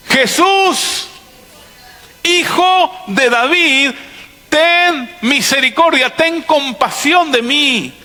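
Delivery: high, drawn-out pleading cry. Acted. Blind beggar calling to Jesús for mercy, crying out. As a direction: cry out for mercy, stretching each phrase. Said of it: "¿Qué gritaba?".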